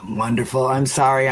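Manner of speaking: throat catching, choking a little; words catching in a choking throat. Real, not acted. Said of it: "I'm choking a little bit tonight".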